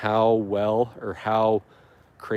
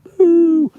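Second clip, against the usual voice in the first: a shrieking, much higher and louder cartoon "boo"; hammed-up boo; 11 dB louder than usual. Booing the bindweed a second time, hammed-up shrieking boo. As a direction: boo it at the top of your lungs, pantomime style.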